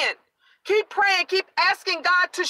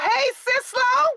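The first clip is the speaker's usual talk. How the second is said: excited greeting; 6 semitones higher than usual. Real, not acted. Greeting viewers joining the live.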